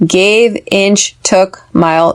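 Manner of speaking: "Gave", "inch", "took", "mile" each said alone as a separate stressed word. Shown as a demonstration. Teacher isolating "Gave", "inch", "took", "mile" as stressed words. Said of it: "These are the stressed words".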